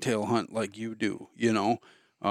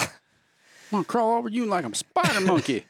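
movie quote; 9 semitones higher than usual. Retold or imitated. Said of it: "Talladega Nights".

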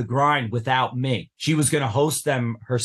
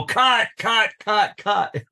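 calling cut; 8 semitones higher, slower than usual. Retold or imitated. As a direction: stop the take with sharp calls. Crew stopping the take.

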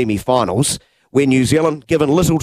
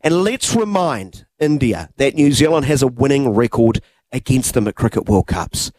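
usual speaking voice

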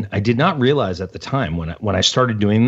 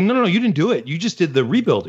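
higher, insistent disbelief; incredulous protest; 7 semitones higher than usual. Retold or imitated. Telling James he never did both, incredulous protest. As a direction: insist he's got it wrong, pitch up. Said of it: "I was like".

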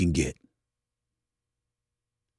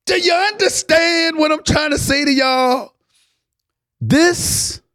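high-pitched, impassioned cry; overcome with emotion; 14 semitones higher than usual. Real, not acted. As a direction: plead to be understood, voice way up. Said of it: "I'm just getting emotional right now".

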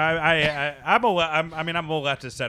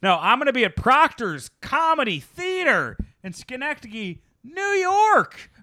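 much higher, stretched-out announcing; silly high voice; 11 semitones higher, 3 dB louder than usual. Real, not acted. Comedian plugging his theater date, in a silly high voice. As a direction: sing out the plug absurdly high, holding the ends. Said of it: "I don't know why I'm talking like this".

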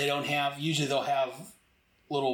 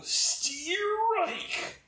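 high, whooping cry of triumph; triumphant shout of strike. Retold or imitated. Hero bowling enemy soldiers shouts strike with glee. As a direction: cheer the strike like a bowler. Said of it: "throws him to the other and says".

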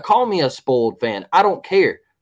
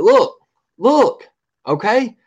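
emphatic call; 7 semitones higher, 4 dB louder, slower than usual. Real, not acted. Heated rant, calling for attention.

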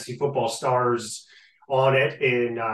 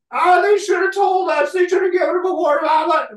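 mock whining shout, much higher and louder; 6 dB louder, faster than usual. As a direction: yell it like a sore loser, high and fast. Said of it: "yelling and screaming".

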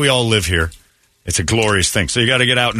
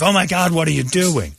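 higher, lightly voiced panicked cry; mock panicked girlfriend; 8 semitones higher than usual. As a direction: imitate the girlfriend's shock, pitched up.

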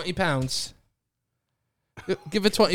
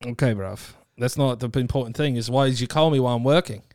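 put-on British accent; 4 semitones lower than usual. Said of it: "you have to do British accent".

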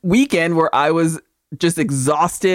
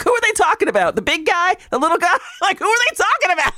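high, confused questions; squeaky bewildered asking; baffled high squeak; 10 semitones higher, faster than usual. Retold or imitated. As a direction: play the lost viewer, pitched way up. Confused quote, pitch way up.

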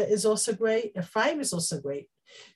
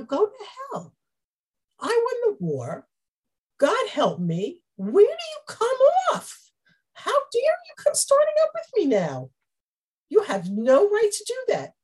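much higher, furious crying-out tirade; angry retort; 11 semitones higher, 4 dB louder than usual. Retold or imitated. Gideon telling off Ephraim's men, furious and shouting. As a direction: blast them for their nerve. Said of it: "He could have said".

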